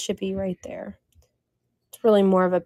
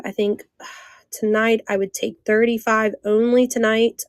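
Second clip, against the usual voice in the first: ordinary talk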